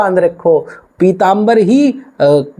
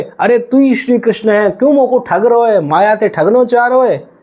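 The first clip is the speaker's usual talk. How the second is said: 1 accusing devotee; 6 semitones higher than usual. Acted. Devotee accusing Krishna of trickery.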